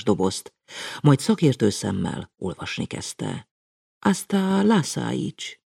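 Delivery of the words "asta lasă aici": calm. A narrator's reading. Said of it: "kezdte nyugodtan".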